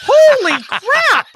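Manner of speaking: very high, loud, drawn-out astonishment; real high-pitched whoop of surprise; amazed outburst. Real, not acted. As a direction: squeal it slowly in sheer amazement. Amazed reaction to the impression.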